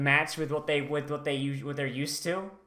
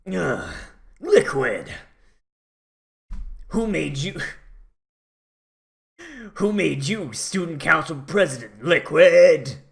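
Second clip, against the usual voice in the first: failed David Hayter voice; 4 dB louder than usual. Retold or imitated. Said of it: "I can't do David Hayter voice".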